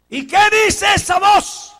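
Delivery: very slow, high and loud; demanding question. Acted. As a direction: drag out the challenge.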